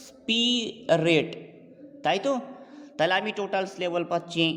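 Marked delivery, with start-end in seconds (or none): 0.1-1.3 "স্পি", short pause, then "রেট"; split into syllables. Shown as a demonstration. Teacher showing two syllables, "স্পি" and "রেট" said apart. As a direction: break the word into two separate beats, "স্পি" and "রেট". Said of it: "স্পি এবং রিট দুটো syllable".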